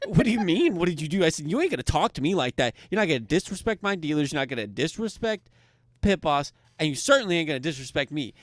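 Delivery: loud, high lecture with punched words; angry scolding. Retold or imitated. Worker scolds player for disrespecting his dealers, loud. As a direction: lay down the law, furious.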